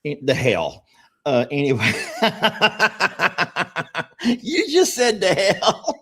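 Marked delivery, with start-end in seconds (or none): 2.0-4.4 high, bursting real laugh. Real, not acted. Host cracks up at co-host's "the hell", bursting laugh. 4.4-6.0 pitched-up words through real laughter; laughing. Real, not acted. Host teases co-host for swearing, laughing through it.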